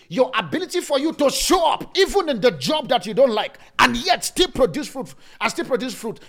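loud, high, driving sermon delivery; preaching at full force. Acted. Pastor urging the congregation to fruitfulness, preaching loudly. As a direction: preach it hard to the church.

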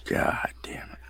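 hushed curse; whispered under the breath; whispering. Real, not acted. Whispered aside.

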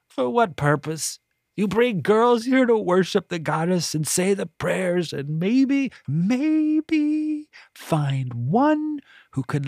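put-on high, disdainful old woman voice; a scornful, self-pitying matron; bitter voluptuary. A narrator's reading. Voluptuary character voice.

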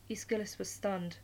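plain reading voice

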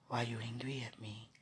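soft unvoiced whisper. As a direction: breathe the question gently. Imagined honest question, whispered.

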